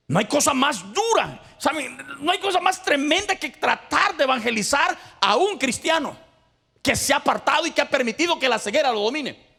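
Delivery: high, loud preacher's cry; impassioned pulpit shout; full-force preaching. Acted. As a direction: preach it hard to the congregation. Preacher's climax on backsliders.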